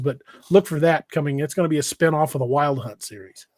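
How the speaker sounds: ordinary talk, nothing marked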